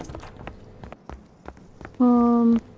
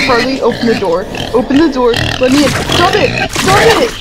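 loud frantic high begging; panicked pleading; 4 semitones higher, 4 dB louder than usual. Real, not acted. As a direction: yell at the door in panic. Begging friend to open door.

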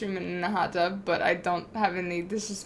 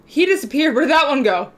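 surprised outcry; 5 semitones higher, 8 dB louder than usual. Real, not acted. In-game character vanishes.

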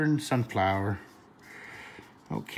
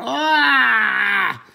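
high, loud squeal of delight, retold; a high, loud fan-girl scream; kids' excited scream; 12 semitones higher, 10 dB louder than usual. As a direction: let out a thrilled kid's shriek. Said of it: "they were screaming and yelling".

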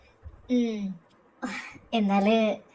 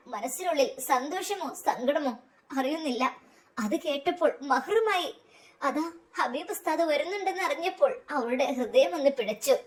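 plain reading voice

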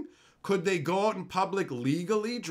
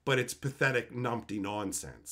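ordinary talk

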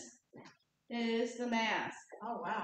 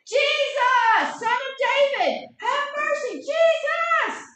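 full-force, very high desperate shout; crying out louder; 14 dB louder, slower than usual. Acted. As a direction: scream it over the crowd. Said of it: "cried out all the more".

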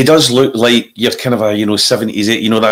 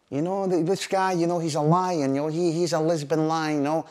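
hushed gangster boast; 9 dB quieter than usual. Retold or imitated. Bertie voiced, mock mafia talk.

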